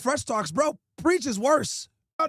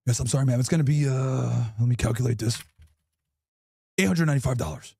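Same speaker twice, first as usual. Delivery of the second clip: deep principal voice; 13 semitones lower than usual. Acted. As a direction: speak low and apologetic like a principal.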